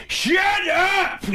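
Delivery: sudden high-pitched comic yell; Adam Sandler. Acted. Third part of the comic impression, sudden high yell. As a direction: explode into a goofy shriek. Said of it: "he yells".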